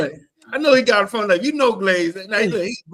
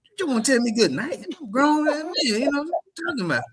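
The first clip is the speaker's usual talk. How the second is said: incredulous retort; 9 semitones higher than usual. Retold or imitated. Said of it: "And I was like".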